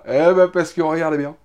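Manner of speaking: ordinary talk, nothing marked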